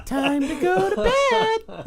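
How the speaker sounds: high, loud, sing-out mock baby voice; little baby. Game master mocking the Teletubbies baby, high baby voice. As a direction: squeal it like a toddler TV character. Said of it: "It's like a little baby".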